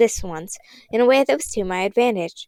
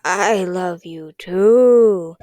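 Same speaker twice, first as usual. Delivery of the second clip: very slow, louder rasping voice; dry raspy voice; 5 dB louder, much slower than usual. Acted. Girl throws a raspy voice from the fireplace. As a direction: rasp it out like something in the fireplace. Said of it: "dry, raspy voice".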